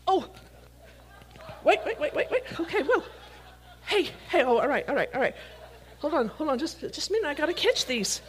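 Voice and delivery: flustered laughing protests while catching; excited scramble. Real, not acted. Preacher laughing as kids throw things at him.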